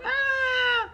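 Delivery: high startled scream; startled shriek. Retold or imitated. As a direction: shriek in surprise. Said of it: "she's like".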